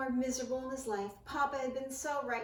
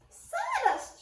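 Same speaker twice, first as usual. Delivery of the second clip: very high shocked shriek; high horrified squeal; 7 dB louder than usual. A narrator's reading. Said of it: "shrieked Ced".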